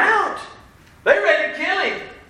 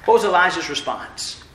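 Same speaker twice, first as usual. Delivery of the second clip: usual speaking voice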